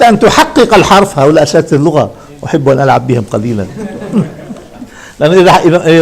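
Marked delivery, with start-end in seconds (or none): none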